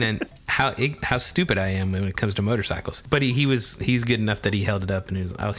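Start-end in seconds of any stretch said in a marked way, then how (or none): none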